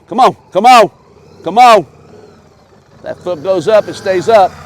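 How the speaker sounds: high, loud distant shout; calling from far away. Real, not acted. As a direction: call out to a rider far off. Coach yelling to rider.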